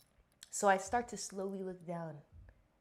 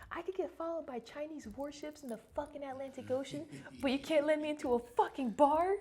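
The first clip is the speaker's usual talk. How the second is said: high-pitched indignant re-enactment; retold screaming rant; 7 semitones higher than usual. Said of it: "I was screaming like that shit".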